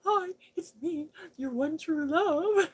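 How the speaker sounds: high, loud, squeaky lovestruck dog; lovesick dog voice. Pretend. The neighbor's dog greeting her, high squeaky lovesick voice. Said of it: "he's all like".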